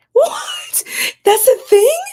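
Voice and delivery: high, loud cry of disbelief; shocked disbelief. Real, not acted. Streamer reacts to a strange pet name, high shocked cry. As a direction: shriek it like you can't believe it. Hears "honey dip" in chat.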